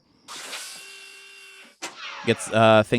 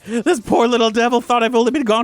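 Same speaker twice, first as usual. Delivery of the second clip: much higher, louder voice, slowing near the end; emotional Doc; 14 semitones higher, 5 dB louder than usual. Acted. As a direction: overcome with affection for the poor dog. Doc moved by faithful dog.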